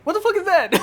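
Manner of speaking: high, loud shocked outburst; shocked yell. Real, not acted. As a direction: blurt it out in disbelief. Shocked at strange character.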